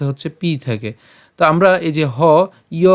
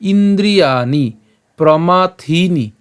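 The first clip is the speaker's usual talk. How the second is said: ইন্দ্রিয়াণি প্রমাথিনি slow, long vowels held; careful drawn-out প্রমাথিনি; long-vowel stress; 3 semitones higher, 4 dB louder, much slower than usual. Shown as a demonstration. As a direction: lean on থি in প্রমাথিনি and stretch it. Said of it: "থিনি কেন উচ্চারণটা করলাম?".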